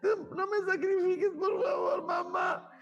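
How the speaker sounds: put-on very high, crying child's plea; niña llorando. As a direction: sob and beg not to be sacrificed. Girl crying to her mother. (niña suplicando entre llanto, muy agudo)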